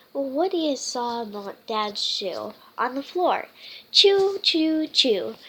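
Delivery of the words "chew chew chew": loud playful chew chew; chewing noises. Acted. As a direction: mimic a puppy chewing. Puppy chewing sounds.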